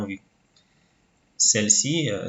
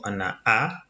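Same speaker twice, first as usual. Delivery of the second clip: ordinary talk, nothing marked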